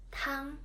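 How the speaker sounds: "Tang" held high and level; first tone tang. Shown as a demonstration. Teacher modelling the first tone word "Tang" for learners. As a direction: keep "Tang" on one high steady pitch. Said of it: "Chinese word with the first tone is tang".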